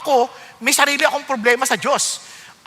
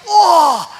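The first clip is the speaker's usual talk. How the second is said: loud, high cry of being hit; imitated stung "oh!"; 4 semitones higher, 9 dB louder than usual. Preacher imitates a listener struck by the Word, loud stung cry. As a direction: blurt a mock pained "oh!".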